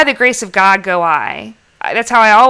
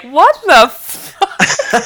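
very slow, higher mock disbelief; Jay look; 8 semitones higher, much slower than usual. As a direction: drag out the baffled curse. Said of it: "give that Jay look like".

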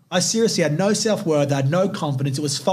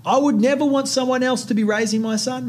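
real tears welling, voice pushed higher; tearful; 6 semitones higher than usual. Real, not acted. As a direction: choke up while pushing through. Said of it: "big tear smiles right now".

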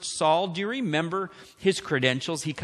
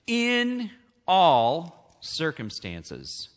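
IN drawn out slowly, set apart; slow, weighty emphasis on "In"; stress on IN; much slower than usual. Shown as a demonstration. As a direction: say "In" long and emphatic, in capital letters. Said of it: "put in capital letters".

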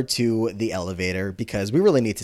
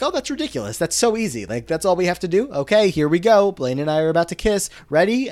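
high-pitched, dismissive mock boys; breezy scoffing; 6 semitones higher, 4 dB louder than usual. Boys scoffing that a kiss is easy, breezy mockery. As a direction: toss it off high and eager.

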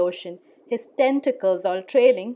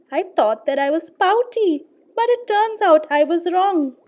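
high, loud, delighted fish voice; joyful fish; 3 semitones higher, 6 dB louder than usual. Acted. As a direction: burst out with happy surprise. Fish joyfully changes his tune.

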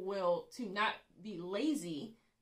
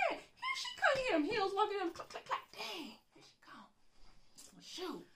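high, comic alarm with giggles; mock panic; 14 semitones higher than usual. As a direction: play it as if the boss is coming. Acts dread of the boss approaching.

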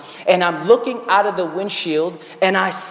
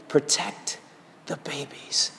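soft hushed voice fading away; God's whisper; 4 semitones lower, 8 dB quieter, slower than usual. Retold or imitated. The Lord whispering through the chaos, hushed and fading. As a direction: breathe it like a quiet voice from God. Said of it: "the Lord whispered to me".

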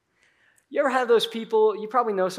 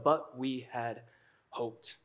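very slow, soft and low; disappointed; 7 semitones lower, 11 dB quieter, slower than usual. Acted. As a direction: voice sinking away in dejection. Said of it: "They're frustrated".

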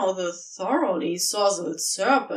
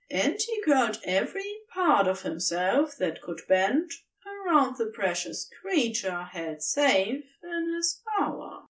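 plain reading voice

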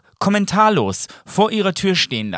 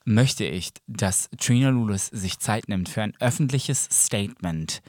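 usual speaking voice

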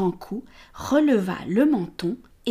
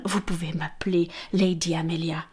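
coy whisper; 3 semitones lower, 3 dB quieter than usual. Acted. Amelia whispers invitation.

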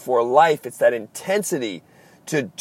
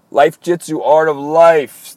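loud catchphrase; 3 semitones higher, 8 dB louder, slower than usual. Acted. Show catchphrase shouted at sign-off.

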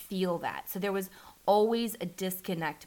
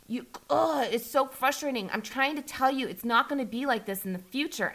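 imitated anger; 6 semitones higher than usual. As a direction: act out his exasperation. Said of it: "he would get angry".

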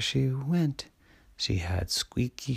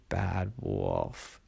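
much lower, deep wolf word; growly wolf; 5 semitones lower than usual. A narrator's reading. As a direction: drop into a big bad wolf. Brother pretending to be wolf.

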